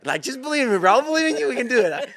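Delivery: rally him like a cheerleader, voice soaring; hyped-up pleading. Retold or imitated. Pleading with physical therapist.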